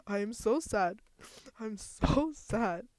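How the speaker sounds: loud, slow whiny mock sob; mock crying. Gamer switching to her crying voice over the lost character. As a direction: wail it like a put-on crybaby. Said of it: "my crying one".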